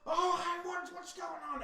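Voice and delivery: high put-on henchman voice; startled crooks. Crooks hear footsteps approaching, high startled voice. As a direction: pitch it way up, caught off guard. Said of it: "they say".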